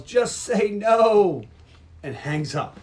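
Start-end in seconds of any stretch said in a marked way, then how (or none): 0.0-1.4 loud, high, long-drawn mock yell; hammed-up parting shout; retold yell. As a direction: holler it slowly into the phone. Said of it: "Then he yells".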